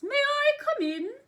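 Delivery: put-on high, loud head-voice old lady. Shown as a demonstration. Teacher playing old lady at the door, high head voice. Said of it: "is our old lady voice".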